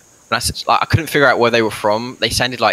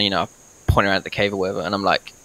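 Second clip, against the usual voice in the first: ordinary talk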